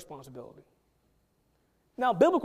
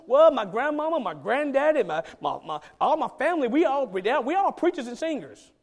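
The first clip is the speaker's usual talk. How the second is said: higher, louder bragging about family preachers; higher, louder mock pride in a church family; boastful churchgoer; 4 semitones higher, 5 dB louder than usual. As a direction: brag about the family, slowing at the end. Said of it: "It will focus on".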